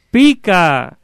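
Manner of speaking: high, drawn-out distant call; echo. Acted. Voice answering from the other side, echoing call. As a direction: stretch it out like an echo, falling at the end. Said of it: "el eco es todo".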